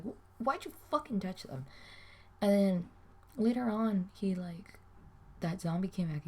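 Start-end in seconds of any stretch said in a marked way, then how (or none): 0.0-1.6 exasperated retort. Retold or imitated. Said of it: "'cause I was like".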